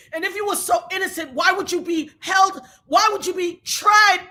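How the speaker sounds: ordinary talk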